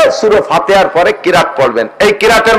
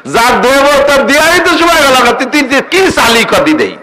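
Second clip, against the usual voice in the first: mock divine rebuke; 8 semitones higher than usual. God's voice imagined, mock rebuke.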